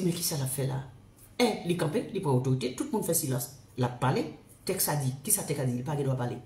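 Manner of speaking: low, soft, grave retelling of the divine voice; voice of God. Retold or imitated. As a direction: speak the Lord's words low and quietly. Rapporte la voix de Dieu. (voix de Dieu, basse et grave)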